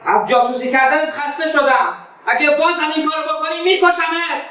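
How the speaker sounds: building furious threat; rising threat. Acted. Son threatens to kill.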